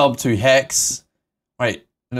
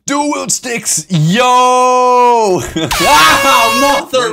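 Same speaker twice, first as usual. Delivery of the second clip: high, drawn-out yell building louder; hyped yelling; 12 semitones higher, 7 dB louder, much slower than usual. Real, not acted. Gamer with two sticks as sub alert hits, hyped yelling. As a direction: whoop it up, laughing into a scream.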